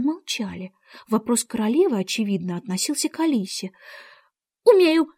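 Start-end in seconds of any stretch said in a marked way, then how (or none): 4.6-5.1 high, loud, firm answer; loud reply. A narrator's reading. Alice answers the Queen's question, just as loudly. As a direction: call back high and loud. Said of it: "так же громко ответила Алиса".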